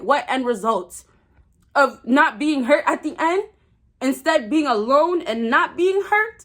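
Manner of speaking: ordinary delivery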